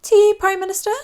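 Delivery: high, loud cutting-in offer; interrupting Queen. Acted. Queen interrupting the prattling Prime Minister. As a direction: break into his boasting with tea. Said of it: "interrupted the Queen".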